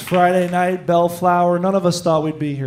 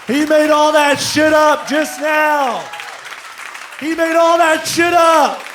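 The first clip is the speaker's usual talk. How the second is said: loud, high hype-man shout to the crowd; 9 semitones higher, 4 dB louder, slower than usual. Real, not acted. Host hyping the crowd, big excited shout. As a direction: pump up the room, shouting.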